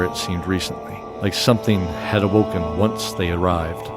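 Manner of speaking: ordinary talk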